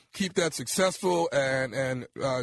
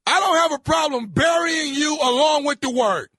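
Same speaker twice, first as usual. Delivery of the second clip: yelling, pitched way up; angry shout; 8 semitones higher, 6 dB louder than usual. Acted. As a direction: bark it at him, furious. Prank caller threatening angrily.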